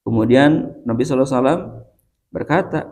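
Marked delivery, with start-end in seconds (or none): none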